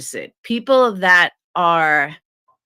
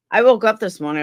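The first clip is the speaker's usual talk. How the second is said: usual speaking voice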